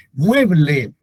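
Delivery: "mueble" repeated with MUE lifted; MUE-ble, first beat strong, then falling; stress on first syllable. Shown as a demonstration. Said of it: "Tiene acento en mue".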